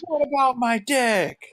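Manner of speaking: high, drawn-out comic holler. Retold or imitated. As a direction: holler it high for all to hear. Acting super loud at Burger King.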